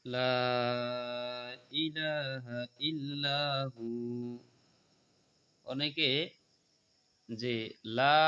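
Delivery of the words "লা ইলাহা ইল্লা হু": slow recitation. Shown as a demonstration. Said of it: "আমার সাথে বলেন".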